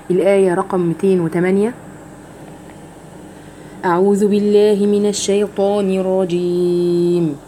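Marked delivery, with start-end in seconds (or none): 3.8-7.4 slow, drawn-out melodic tajweed recitation; Quran recitation. Shown as a demonstration. Teacher recites the verse for her students, slow melodic chant. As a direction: chant each verse slowly and evenly.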